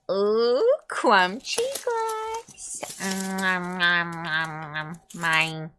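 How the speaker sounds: lightly voiced munching critter; greedy creature. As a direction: savor it like a little gremlin. Playing a glass-eating creature.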